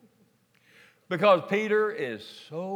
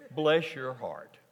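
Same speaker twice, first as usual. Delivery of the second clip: Texas drawl; 7 semitones lower, 4 dB quieter, slower than usual. Shown as a demonstration. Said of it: "New Revised Texas translation".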